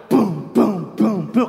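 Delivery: punchy boom sound effects; four mouthed blows in a row; imitated punches. Imitating his punches to the stomach.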